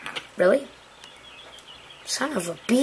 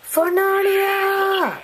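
high, long-drawn-out war cry; mock battle cry; 12 semitones higher, 3 dB louder, much slower than usual. Player launching birds in a game, mock battle cry. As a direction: stretch it out high like a hammed-up charge.

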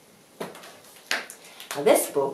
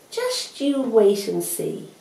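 defiant; 5 semitones higher, 6 dB louder, slower than usual. Acted. Tortoise defies the doubters again.

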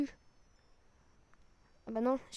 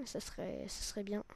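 whispering; 5 semitones lower, 13 dB quieter than usual. Real, not acted. Concentrating on a hard jump.

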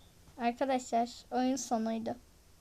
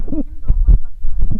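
loud complaint; 12 dB louder than usual. Real, not acted. Game failing.

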